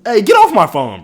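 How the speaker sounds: loud, high yell at someone; real shout. Real, not acted. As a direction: snap loudly to shoo them off. Yelling at someone nearby.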